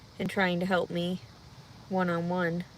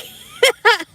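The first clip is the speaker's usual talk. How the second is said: laugh; 13 semitones higher, 14 dB louder than usual. Real, not acted. Burst of laughter.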